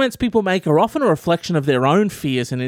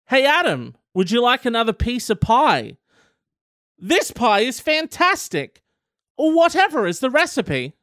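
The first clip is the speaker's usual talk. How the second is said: mock compliment; 5 semitones higher than usual. Dodging a second helping of pie with high fake praise. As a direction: gush about the pie to dodge it. Role-playing the pie scenario.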